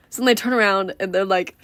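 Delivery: ordinary delivery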